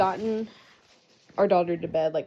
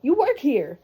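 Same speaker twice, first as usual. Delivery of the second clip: high-pitched, loud, incredulous retort; exasperated disbelief; 11 semitones higher, 5 dB louder than usual. Retold or imitated. Patient in labour to lost transport worker, exasperated disbelief. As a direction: act out baffled exasperation, voice shooting up.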